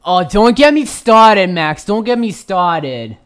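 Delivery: very loud, high hammed-up protest; mock outburst. Cutting off Max about backgrounds, loud mock outburst. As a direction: blow up theatrically, then stretch the repeat.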